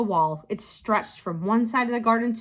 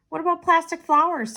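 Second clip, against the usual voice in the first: put-on higher, bright-eyed child's suggestion; little girl Theo; 9 semitones higher, 4 dB louder than usual. A narrator's reading. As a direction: pitch up like an eager little girl. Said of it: "suggested Leo".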